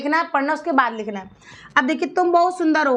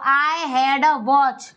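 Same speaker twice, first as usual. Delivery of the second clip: very slow, word by word; slow first take to copy; slow model sentence; much slower than usual. Shown as a demonstration. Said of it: "teacher models then repeats". (बहुत धीरे, शब्द-दर-शब्द)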